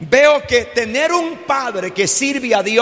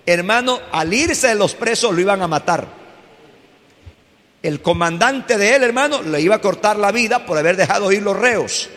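ordinary talk, nothing marked

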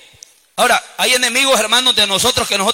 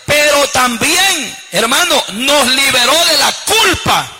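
slow, raised proclamation; impassioned preaching; 3 semitones higher, slower than usual. Real, not acted. Preacher proclaiming freedom from guilt to congregation, impassioned. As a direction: draw it out as the climax.

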